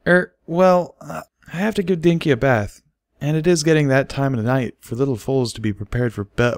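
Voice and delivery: high, hesitant mare voice; fumbling for an excuse, high; flustered Derpy. A narrator's reading.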